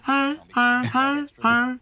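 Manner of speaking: high, loud honks voiced as hi; honking imitation. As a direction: honk like a goose, in rhythm. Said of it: "[name] and [name] honking in time".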